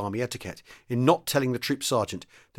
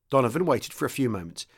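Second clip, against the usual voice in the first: ordinary delivery, nothing marked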